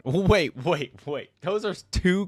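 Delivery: laughing disbelief. Real, not acted. Stunned by the lore twist.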